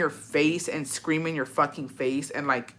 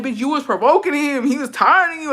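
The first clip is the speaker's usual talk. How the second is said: talking back; 8 semitones higher, 4 dB louder than usual. Retold or imitated. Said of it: "I'm like".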